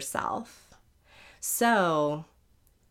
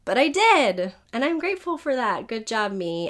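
excited; 4 semitones higher, 5 dB louder than usual. Real, not acted. Proud of finishing despite voice.

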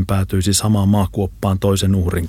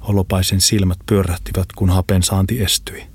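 plain reading voice

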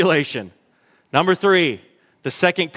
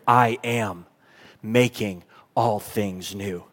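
slow, low and softer; solemn declaration; 8 semitones lower, 4 dB quieter, slower than usual. Acted. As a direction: let it sink in, hushed and slow. God's declaration repeated.

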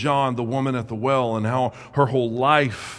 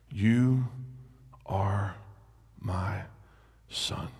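tender reading; 4 semitones lower, 4 dB quieter than usual. Acted.

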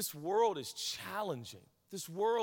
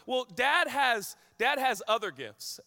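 higher, louder, slow playful jab; teasing mom; 8 semitones higher, 7 dB louder than usual. Retold or imitated. Higher, louder, slow teasing as wife jokes about dad. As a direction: let the mother tease the dad with a slow wink.

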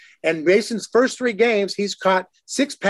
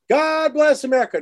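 mock stadium announcer call, pitched up; ballpark announcer; 8 semitones higher than usual. Imitating the PA announcer opening the game, mock announcer call. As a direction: boom it out like the PA man before first pitch.

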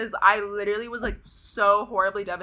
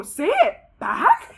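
slow, high, stretched disbelieving question; incredulous echo; 8 semitones higher, 3 dB louder, much slower than usual. Retold or imitated. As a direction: throw his words back at him in disbelief. Echoing his demand in disbelief.

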